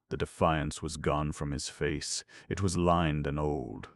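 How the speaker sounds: ordinary delivery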